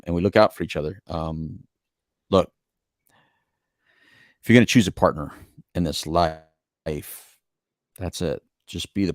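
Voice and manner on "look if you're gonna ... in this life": ordinary talk, nothing marked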